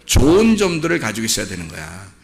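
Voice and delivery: usual speaking voice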